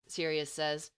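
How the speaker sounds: ordinary delivery, nothing marked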